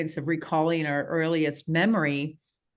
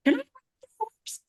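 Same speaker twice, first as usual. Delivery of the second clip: put-on high, fast little-girl voice begging to ride; little girl asking; much faster than usual. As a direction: squeak it out like an eager four-year-old. Retelling her child self begging.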